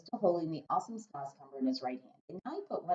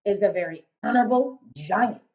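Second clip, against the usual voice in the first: big loud giant, muddling his words; 4 semitones higher, 10 dB louder than usual. A narrator's reading. Giant grumbling to Sophie about snozcumbers, big booming voice. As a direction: boom it out as a kindly giant.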